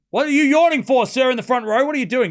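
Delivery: loud and high, scolding a stranger; mock indignant call-out. Real, not acted. Scolding a yawning front-row fan, loud and high.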